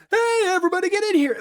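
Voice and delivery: high, quick mock call. Podcaster acting Billy calling friends in, calling out.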